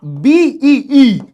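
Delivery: "बी ई ई" with the ee drawn out very long; stretched ee. Shown as a demonstration. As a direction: drag the vowel of "बी ई ई" like a buzzing bee. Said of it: "जबरदस्ती दो ई लगाओ". ("बी ई ई" को बहुत खींचकर)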